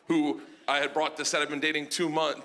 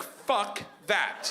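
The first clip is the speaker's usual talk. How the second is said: refusal; 3 semitones higher than usual. Acted. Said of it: "I was like".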